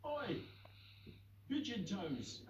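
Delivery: rough dog mocking a pigeon; barked. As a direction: bark it at the pigeon. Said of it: "barked a dog".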